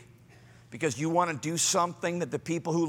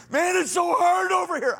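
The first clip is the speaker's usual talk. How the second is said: high shouted whine; shouting complainer; 12 semitones higher, 6 dB louder than usual. Retold or imitated. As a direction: wail it at the friend. Acted whiny complainer quarrel.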